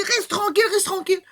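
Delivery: high, loud telling-off; crowd hushing. Acted. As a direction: shut him up, high and loud. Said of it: "il fait ça, il dit".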